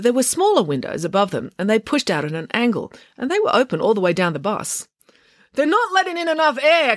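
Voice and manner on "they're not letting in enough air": high-pitched, panicky complaint; agitated high whine of protest; complaining. A narrator's reading. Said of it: "complained April".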